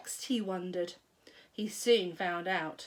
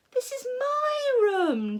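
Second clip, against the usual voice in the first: put-on much higher, slow whining boy; upset boy; 13 semitones higher, 4 dB louder, slower than usual. A narrator's reading. George protesting to Grandma about his room. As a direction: whine in protest.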